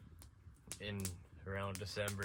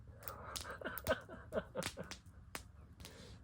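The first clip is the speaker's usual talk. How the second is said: laughs; 6 dB quieter than usual. Real, not acted. Laughing at own story.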